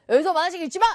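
loud, quick scolding order; blunt command. Shown as a demonstration. Teacher showing the order without please, loud and blunt. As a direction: forbid it firmly. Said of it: "이게 아니라".

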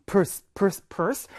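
purse repeated in short beats like a pulse; heartbeat rhythm. Shown as a demonstration. Teacher linking word to pulse, purse repeated in heartbeat rhythm. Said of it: "맥박 소리로 여러분들 기억해".

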